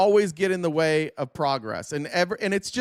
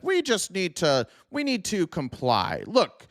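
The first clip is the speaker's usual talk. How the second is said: a hammed-up Tory telling rebels to leave; mock loyal colonist; 6 semitones higher than usual. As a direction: whine out the king's loyalist. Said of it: "would be like".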